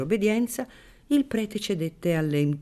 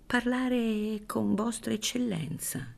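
timid; 9 semitones higher, 4 dB quieter, slower than usual. Acted. Frightened maid answers.

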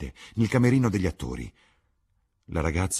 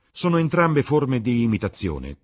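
lightly voiced witty lord, a bit louder; Lord Henry's quip; 8 semitones higher, 9 dB louder than usual. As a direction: toss off the epigram with ease. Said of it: "commentò Lord Henry". (Lord Henry ribatte con ironia leggera)

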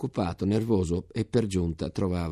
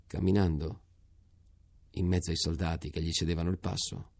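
ordinary delivery

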